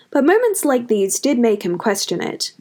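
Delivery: ordinary delivery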